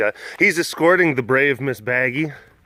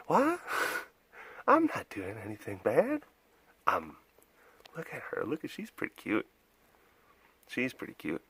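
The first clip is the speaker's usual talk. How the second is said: much softer, voicing the innocent dog; soft pet voice; 12 dB quieter than usual. Voicing the dog to the viewers, much softer. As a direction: murmur softly as the pet.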